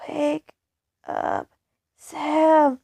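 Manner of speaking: lightly voiced scream to wake up; the dead self screaming at Sam; mild scream. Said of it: "she screams".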